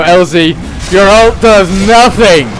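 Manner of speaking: loud, slow, drawn-out taunt; mocking shout. Real, not acted. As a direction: jeer it across the room. Taunting a hero after a fight.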